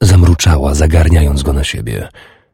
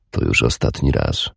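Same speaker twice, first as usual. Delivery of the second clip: low, soft man's voice; 6 semitones lower, 7 dB quieter than usual. A narrator's reading. Warrior answering Urdis, low and soft. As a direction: lower and softer, tired promise.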